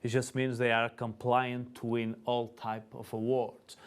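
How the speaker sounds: ordinary talk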